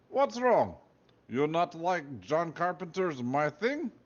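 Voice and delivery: high, slow puzzled Russian guard; puzzled guard in a put-on Russian accent. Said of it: "He had a thick Russian accent".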